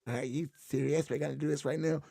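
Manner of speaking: lightly voiced skeptic asking twice; doubtful skit voice. Second of four voices he did in one take, doubtful. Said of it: "me doing the voices".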